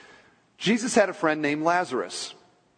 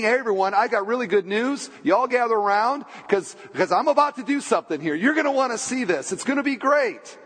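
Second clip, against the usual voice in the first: excited, higher-pitched rallying of a crowd; imagined happy announcement, pitched up; smiling good-news announcement; 6 semitones higher than usual. Pretend. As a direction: gather everyone round with a big smile. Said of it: "I'm thinking a smile".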